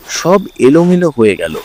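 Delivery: ordinary delivery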